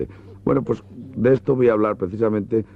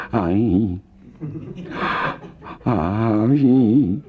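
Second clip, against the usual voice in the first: moaning; 3 semitones higher than usual. Acted.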